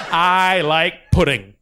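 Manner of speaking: slow, drawn-out happy declaration; distracted auctioneer. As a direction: stop the chant and stretch each word. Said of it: "He's got A D H D".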